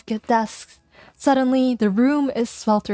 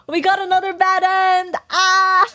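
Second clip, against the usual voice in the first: shocked excited; 10 semitones higher than usual. Real, not acted. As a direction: squeal at the bad ending. Shock at bad ending.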